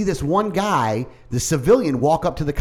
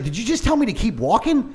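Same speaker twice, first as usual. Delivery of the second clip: indignant challenge; 8 semitones higher than usual. Retold or imitated. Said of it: "I, like".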